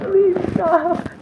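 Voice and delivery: high, loud pleading; retold plea. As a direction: plead loudly for it to stop. Retelling her plea to husband.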